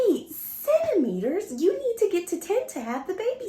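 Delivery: retold shock at being far into labor; disbelief. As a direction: gasp it out in utter disbelief.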